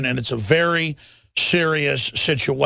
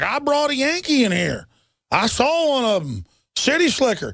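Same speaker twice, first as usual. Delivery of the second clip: pitched-up mock rural hillbilly accent; 8 semitones higher than usual. Host imitates rural folks spotting a Yankee, higher mock hillbilly accent. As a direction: play a backwoods old-timer sneering at outsiders. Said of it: "that do talk like this".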